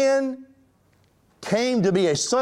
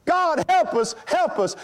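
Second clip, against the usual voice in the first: high-pitched plea to God; imitated cry for help; 11 semitones higher than usual. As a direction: act out mankind crying out for rescue. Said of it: "God heard the cry of mankind".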